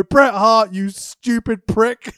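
slow, loud mock insult; mock yell. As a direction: spit out the insult slowly. Mocking wrestler's interview answer.

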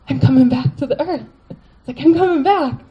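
half-crying with joy at coming back to earth; retold excitement. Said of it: "I just got really excited".

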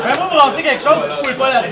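loud high objection; protesting. Acted. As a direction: push back firmly. Player defends his bag.

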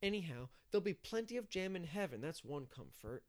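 put-on child's self-satisfied voice, starting high; complacently. A narrator's reading. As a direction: settle contentedly into your own consolation. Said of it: "he said complacently".

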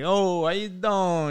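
slow, drawn-out East Coast Italian "how you doing"; mock East Coast Italian greeting, stretched. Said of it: "we say, like".

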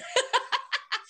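high, giggly laugh. Real, not acted. Laughs at own joke.

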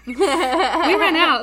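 slow, loud laughing repeat. Real, not acted.

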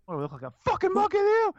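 high, fast indignant shout; hammed-up offended outburst; mock angry retort. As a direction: snap back like you've been insulted. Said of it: "retorting to being soothed".

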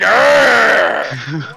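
shouted mock roar of effort; mock wrestler roar. Voicing the wrestler mid-move, mock roar. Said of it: "She was like".

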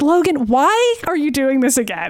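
rising into high, slow exasperation; exasperated Storm. Retold or imitated. Storm face palming at Logan, high slow exasperation. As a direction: sigh it out, fed up with Logan. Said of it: "Storm is, like, face palming".

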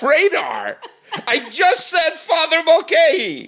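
high, hammed-up exasperated shout; mock loud yelling. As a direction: yell it high, with laughter behind it. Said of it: "You're talking so loud".

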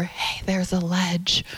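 soft, slow, gentle whisper; hushed, drawn-out whispered heads-up. Acted. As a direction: whisper it like a quiet, gentle warning. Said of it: "you know, whisper".

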